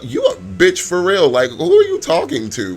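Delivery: loud, high, indignant challenge. Acted. Man confronting the woman who insulted him, loud and angry. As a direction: fire back at full volume. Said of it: "I suggest you lower your tone".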